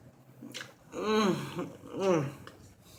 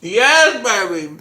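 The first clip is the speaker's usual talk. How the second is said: excited; 4 semitones higher, 9 dB louder, slower than usual. Real, not acted. Delighted by the food.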